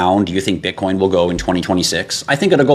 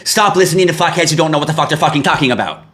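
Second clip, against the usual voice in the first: irritated inner rant; 8 semitones higher than usual. Retold or imitated. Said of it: "in the back of my head is like".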